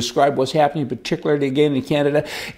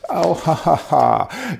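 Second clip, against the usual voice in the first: ordinary talk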